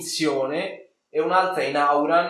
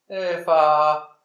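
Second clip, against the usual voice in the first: ordinary delivery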